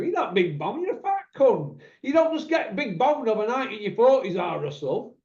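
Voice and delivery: high, mocking family member; blunt relative. Relative mocking Russell's weight, high and blunt. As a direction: cut him down to size. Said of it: "a member of my family go".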